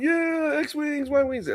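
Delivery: mock fanboy glee. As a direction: squeal it like a thrilled fan. Re-enacting own excitement.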